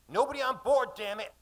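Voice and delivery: high, loud barked order; angry shout. Acted. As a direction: shout it at the crew.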